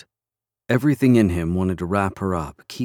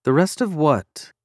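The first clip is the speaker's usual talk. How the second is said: high, slow, drawn-out puzzlement; puzzled question; 8 semitones higher than usual. A narrator's reading. Kayla, left out of the plan, asks the men, puzzled. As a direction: ask it higher and slower, confused. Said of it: "Kayla's brows drew together".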